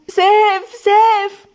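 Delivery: high, loud anguished cry; desperate high-pitched wail; tearful scream. A narrator's reading. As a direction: scream his name in pain and panic. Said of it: "دموع وصريخ ووجع".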